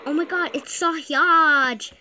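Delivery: high, loud, slow gasp of excitement; hammed-up thrilled yell; mock excited shriek. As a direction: shout it like spotting a celebrity. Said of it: "she's just like".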